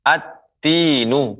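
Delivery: AT-TINU with the lam merged into a doubled t; assimilated lam. Shown as a demonstration. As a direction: draw out "at-tinu" slowly, holding the t and long i.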